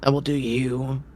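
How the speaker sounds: a gruff, impatient circus ringmaster scolding. Acted. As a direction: grumble at the late performer. Ringmaster reads schedule.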